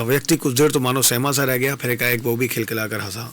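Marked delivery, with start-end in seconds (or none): none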